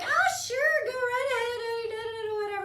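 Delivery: hammed-up carefree agreement to the trash man; mock breezy. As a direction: toss it off with playful airiness. Retells own breezy reply to trash man.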